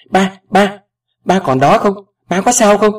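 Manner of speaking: high, loud alarmed questions; panicked. A narrator's reading. Daughter fearing her father fainted on the phone, panicked. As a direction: call anxiously for someone who went silent. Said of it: "Tôi hốt hoảng hỏi".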